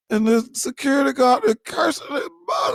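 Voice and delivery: high-pitched, strained cry of disbelief; incredulous outburst. Acted. Churchgoer outraged that a guard curses on church ground, incredulous outburst. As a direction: squeal it out, scandalized.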